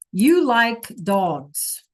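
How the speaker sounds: very slow You like dogs, falling; slow gloss. Shown as a demonstration. Teacher slowly translating for students. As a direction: drag out each word.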